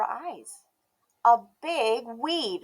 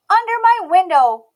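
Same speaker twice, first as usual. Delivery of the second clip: put-on high, bright little girl voice, loud and proud; a stubborn little girl declaring herself; Emmy's child voice; 10 semitones higher, 15 dB louder than usual. A narrator's reading. Emmy deciding.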